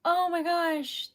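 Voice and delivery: ordinary talk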